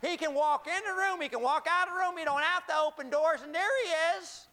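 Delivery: high-energy pulpit storytelling; animated preaching. Acted. Preaches at full force.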